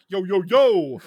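high, loud robot-sidekick call; loud high yo yo yo greeting; Alpha Six voice. Retold or imitated. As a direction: shout it like the Turbo-era robot. Imitating Alpha 6's catchphrase.

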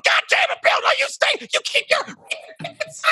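put-on high, yelling old man scolding Bill; angry shout. Old man yelling at Bill to stay out. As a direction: bark it out in a high, furious yell.

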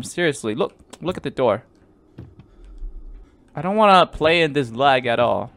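usual speaking voice

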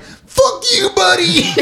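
very high, strained cartoonish scream; mock-furious squealing yell; Bobcat Goldthwait. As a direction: screech it as a comic's angry comeback. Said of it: "Bobcat actually doesn't do the voice anymore".

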